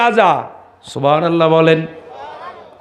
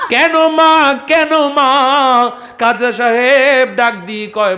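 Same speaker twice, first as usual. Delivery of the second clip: preaching at full force; 8 semitones higher than usual. Acted. Preacher telling a saint's story to the crowd, at full force. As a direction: call it out to the crowd like a village waz.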